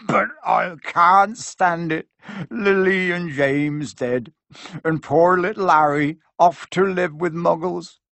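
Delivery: slow, grieving giant; slow, heartbroken gamekeeper; Hagrid voice. A narrator's reading. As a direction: lament it slowly and heavily.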